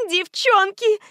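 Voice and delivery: very high, piercing shout; shrill holler from another room; piercing call. A narrator's reading. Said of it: "её пронзительный".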